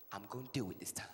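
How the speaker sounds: low, hushed vow; low threat. Acted. Mom in the window warns her child, low and hushed. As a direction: murmur it low and soft.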